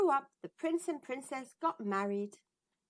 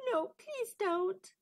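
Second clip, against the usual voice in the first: princess pleading; 9 semitones higher, slower than usual. Acted. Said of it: "said the princess".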